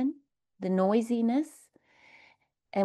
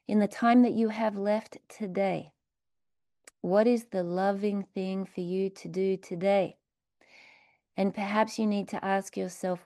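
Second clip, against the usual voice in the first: usual speaking voice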